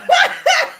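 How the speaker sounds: high, loud burst of laughter; sudden exasperated cackle. Real, not acted. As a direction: burst out laughing at being overwhelmed. Laughs after needing a minute.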